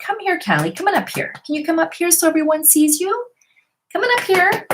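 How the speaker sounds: high sing-song dog talk; high coaxing pet voice. Real, not acted. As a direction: coax the dog up to the camera, high and sweet.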